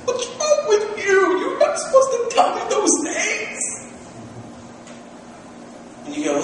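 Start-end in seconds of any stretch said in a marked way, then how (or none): 0.0-3.6 mock scolding. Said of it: "people go like".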